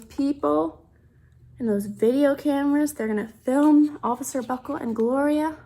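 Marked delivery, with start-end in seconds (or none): none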